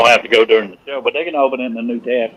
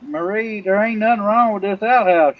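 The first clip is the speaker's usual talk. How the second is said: higher voice calling back to her; 6 semitones higher than usual. Acted. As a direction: yell across the yard. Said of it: "yells back".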